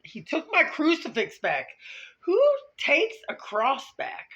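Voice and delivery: high, loud incredulous cry; outraged disbelief. Real, not acted. Telling viewers about the crucifix, in outraged disbelief. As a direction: rise in indignant disbelief.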